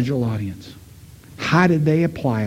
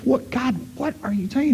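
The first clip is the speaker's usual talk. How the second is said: higher, softer puzzled asking; bewildered question; 9 semitones higher, 4 dB quieter than usual. Retold or imitated. Father-in-law asking God what he means, bewildered and higher. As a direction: sound baffled, reaching for an answer.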